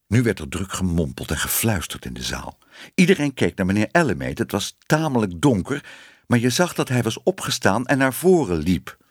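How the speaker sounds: plain reading voice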